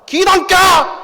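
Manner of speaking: high, loud Jeolla insistence; yelled at the confused customer; shouting seller. Acted. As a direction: snap back like a stubborn vendor.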